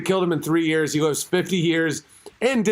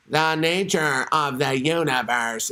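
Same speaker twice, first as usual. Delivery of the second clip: slow, lower American robot voice; American-accented Hawking; 6 semitones lower, slower than usual. Retold or imitated. Host imitating Hawking's machine, American robot accent. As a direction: drone it as an American-accented synthesizer. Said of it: "why do you have an American accent?".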